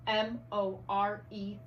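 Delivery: "M O R E" spelled slowly, one letter at a time; spelling out letters. Shown as a demonstration. Teacher modelling spelling to first graders. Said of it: "This is how I want you doing it".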